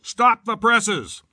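high, slow shout into the phone; shouted order. A narrator's reading. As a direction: bark the order, drawn out. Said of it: "shouted into it".

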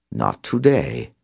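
put-on European accent, matter-of-fact denial; flat European contradiction. A narrator's reading. Caller corrects Cobb, flat European accent. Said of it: "the European just as flatly contradicted".

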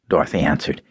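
plain reading voice

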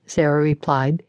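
usual speaking voice

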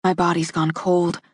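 ordinary delivery

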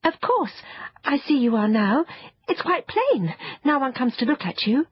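put-on high-pitched girl, eager to appease; hastily placating. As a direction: hurry to soothe hurt feelings. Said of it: "Jane hastened to say".

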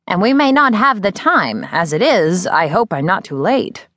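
a mentor hinting at danger; Miz Terwilliger. Acted. Older teacher warning her former student, lightly voiced and grave.

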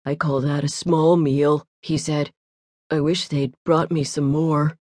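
a big, unhurried eater shrugging it off; glutton voice. A narrator's reading.

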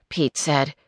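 usual speaking voice

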